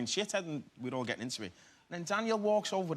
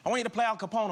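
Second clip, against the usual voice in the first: imitating Scorsese; 8 semitones higher, 5 dB louder, faster than usual. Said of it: "He went".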